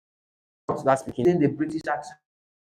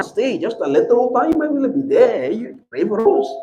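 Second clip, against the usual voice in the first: imitating Indian accent; 7 semitones higher, 4 dB louder than usual. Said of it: "That's the way they speak".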